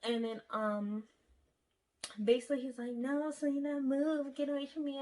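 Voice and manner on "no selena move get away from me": mocking him. Boyfriend voiced pushing her away.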